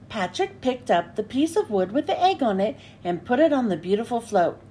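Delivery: ordinary delivery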